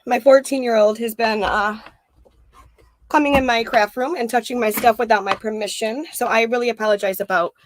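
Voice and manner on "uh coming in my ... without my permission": ordinary talk, nothing marked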